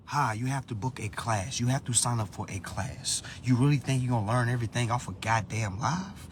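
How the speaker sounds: low, quiet receptionist-style brush-off; unimpressed, softly spoken put-down; deadpan reply. Acted. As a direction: drop it down and answer drily. Dry acted reply to eager followers.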